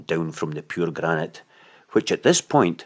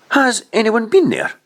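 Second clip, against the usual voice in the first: much higher and louder, eager; eager question; 8 dB louder than usual. A narrator's reading. Anna eagerly asking Simeon about the caves, high and loud. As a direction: ask it breathless with hope.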